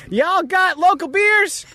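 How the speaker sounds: very high, slow, drawled Southern twang; slow, high mock Southern twang; impression of Amanda. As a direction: tease her with an over-the-top Southern drawl. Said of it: "I'm gonna do my impression of Amanda walking in".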